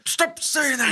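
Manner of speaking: whiny villain. Retold or imitated. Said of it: "Count Rugen's like".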